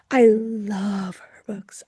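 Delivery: slow, breathy whisper of "I love her books". Shown as a demonstration. Repeats the phrase twice.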